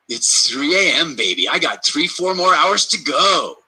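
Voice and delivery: slow, gleeful and rising; mock delighted. Imitating a happy midnight wake-up, slow and rising. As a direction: savour the extra hours of sleep. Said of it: "Now I go".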